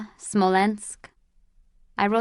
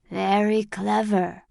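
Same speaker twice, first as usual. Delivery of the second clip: put-on slow old man voice. A narrator's reading. Old man quietly approves plan.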